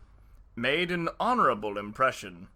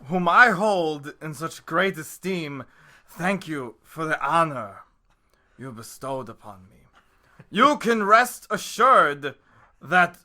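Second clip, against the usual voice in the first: Christopher Walken impression; 8 dB louder than usual. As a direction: pause oddly and punch it like Walken. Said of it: "more Christopher Walken-ish".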